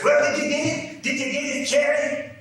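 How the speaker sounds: loud, high, excited holler; shouted demand. Acted. Father turning to Dixie, shouting his question. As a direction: yell the question right at them.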